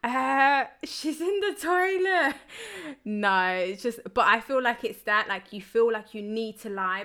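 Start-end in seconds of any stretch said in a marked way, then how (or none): 0.0-2.4 high, slow, nervous made-up excuse; panicked fib. Retold or imitated. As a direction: stall and fib, voice pitched up. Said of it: "I was like".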